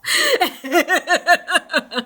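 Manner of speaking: a real high giggling fit; high giggle. Real, not acted. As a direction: burst into giggles. Laughing at the scandal.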